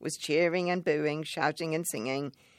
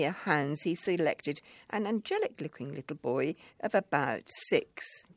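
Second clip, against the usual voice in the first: plain reading voice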